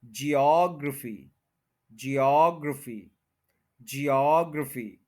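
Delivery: Geography as slow jee-AWG-ruh-fee, falling; second-syllable stress. Shown as a demonstration. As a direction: model geography slowly, weight on AWG. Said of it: "second पे stress है".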